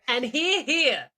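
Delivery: mock hear hear. Mock parliamentary cheer.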